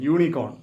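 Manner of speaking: യൂണിക്കോൺ starting with a "yoo" glide; initial Y sound. Shown as a demonstration. Teacher shows why it takes short article, Y sound opening യൂണിക്കോൺ. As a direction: begin യൂണിക്കോൺ on a consonant Y. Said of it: "ആ വൈയിലാണ് അതിൻ്റെ pronunciation".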